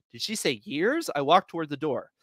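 plain reading voice

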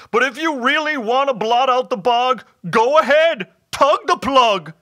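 high, loud wailing dare; loud, martyred goblin outburst; upset hobgoblin. A narrator's reading. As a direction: throw it out in a hammed-up creature wail. Hobgoblin dares Bob.

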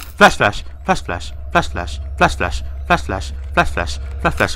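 ordinary talk, nothing marked

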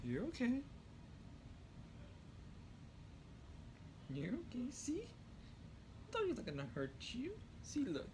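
gently calm a frightened pet; soothing pet voice. Real, not acted. Reassuring the scared cat near the dog, high hushed coo.

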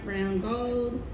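usual speaking voice

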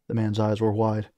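plain reading voice

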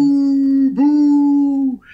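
long, high, loud mimicked boo; crowd booing. Audience booing a frozen amateur comic, drawn-out imitation. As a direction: hold each boo like a jeering crowd. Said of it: "and people went".

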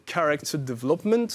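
self-mocking English accent on character development. Shown as a demonstration. To his viewers, showing off his English accent. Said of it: "avec mon accent excellent".

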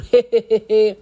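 loud, staccato chuckle; a run of he-he laughs; amused chuckle. Real, not acted. Gossip vlogger laughing to viewers, amused chuckle.

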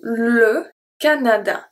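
Le Canada said slowly, with the e of Le clearly voiced; French pronunciation demo. Shown as a demonstration. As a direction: model Le Canada slowly for learners. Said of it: "the E is going to be pronounced as a E".

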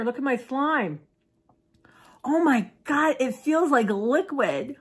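high, slow giddy amazement; overwhelmed surprise. Real, not acted. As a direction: gush with wonder. Excited about liquid slime.

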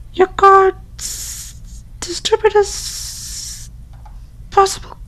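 put-on high, hushed, whispered girly voice; girl voice whispering. Friend whispering spooky morgue facts through the door.

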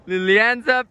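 usual speaking voice

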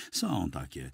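ordinary delivery

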